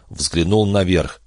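ordinary delivery

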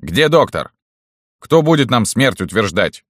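high, loud barked demands; barking. A narrator's reading. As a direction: bark it out. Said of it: "рявкнул Левкой".

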